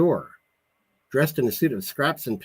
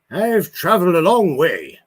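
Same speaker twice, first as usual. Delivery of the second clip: irritated; 7 semitones higher, 8 dB louder than usual. Acted. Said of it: "said with irritation".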